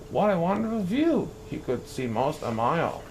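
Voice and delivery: ordinary delivery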